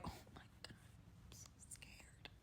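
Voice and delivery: barely audible whisper, slowing down; scared inner whisper. Retold or imitated. Hiding fear from the date in the back of her head, scared whisper. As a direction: whisper a hidden panic to yourself. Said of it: "In the back of my head".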